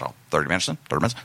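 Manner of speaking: low, mumbled impression; low and casual, words run together; Robbie's voice. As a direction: imitate a low-voiced comic. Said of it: "he talked like this".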